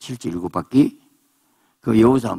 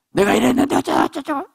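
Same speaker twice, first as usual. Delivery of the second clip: very high, stammering whine; whining complaint. Acted. Jacob-like believer whining at God in prayer.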